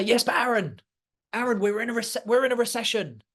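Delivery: lightly put-on voice of someone objecting; mock objector. Voicing worried objectors in mock exchange.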